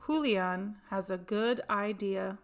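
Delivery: plain reading voice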